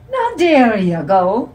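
slow, loud hammed-up Irish accent; put-on Irish accent. A narrator's reading. Dad to his daughter, hammed-up Irish accent. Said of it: "in his put-on Irish accent".

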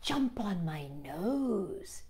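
soft, very slow coaxing; sly fox. Acted. The fox luring the gingerbread man to its nose, soft and slow. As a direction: purr the last trap slowly. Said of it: "said the wily red fox".

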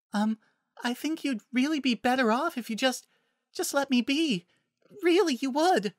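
tiny, very high, hesitant voice; shy, high-pitched and faltering; timid Fluttershy. Acted. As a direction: plead meekly in a high little voice.

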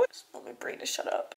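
unvoiced, hushed and weary; tired whisper. Real, not acted. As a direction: breathe it out in frustration. Exhausted, overwhelmed venting.

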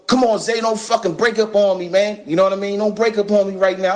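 urgent, half-acted plea to a friend; pleading friend. [name] pleading while shot.

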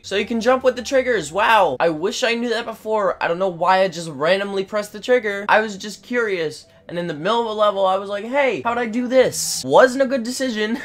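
high, loud, rushing amazement; excited discovery. Real, not acted. Gamer finds trigger makes you jump, excited and loud.

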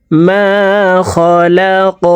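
slow, drawn-out chanted recitation; Quranic recitation. Shown as a demonstration. Teacher reciting to students, slow melodic tajweed chant. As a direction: recite it melodically, stretching each vowel.

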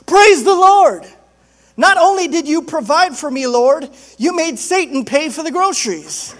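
high, very loud cry of praise; exultant shouting. Acted. Lady answering the gloating atheist, exultant shouting. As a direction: shout it in gleeful triumph. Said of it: "and she shouts".

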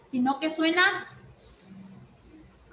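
"S" in "Sino que suena S" hissed long and slow like a snake. Shown as a demonstration. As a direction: hiss the "S" slowly, like a snake. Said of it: "como una serpiente". ("S" siseada y lenta como una serpiente)

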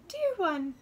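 high, loud little bird voice; chirping wren. Acted. Wren greets the girl who called, high chirp. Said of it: "the wren chirped".

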